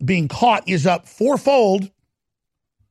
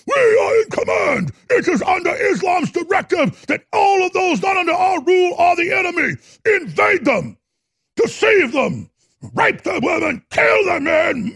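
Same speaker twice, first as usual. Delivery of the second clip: fanatic shouting imitation; 12 semitones higher than usual. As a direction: scream orders like a zealot. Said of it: "they're literally".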